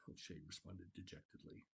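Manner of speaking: plain reading voice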